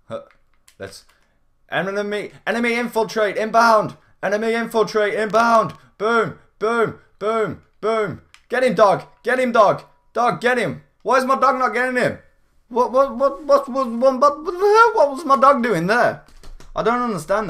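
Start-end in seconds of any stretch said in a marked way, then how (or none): 1.7-5.7 loud high military alert; alarm call. Acted. Calling alarm like a soldier. 6.0-17.5 loud high frantic yelling; frantic shout. Real, not acted. Frantic combat, dog not attacking.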